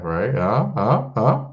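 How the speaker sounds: ordinary talk, nothing marked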